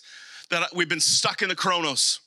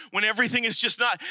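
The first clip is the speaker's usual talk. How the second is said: ordinary talk, nothing marked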